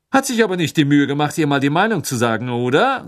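loud, fast and pointed, meant to be overheard. A narrator's reading. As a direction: call it out fast so everyone hears. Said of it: "so laut". (laut und schnell, spitz, damit alle es hören)

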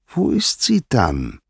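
slow, high-pitched pressing; insistent question. A narrator's reading. Poseidon repeats question, insisting.

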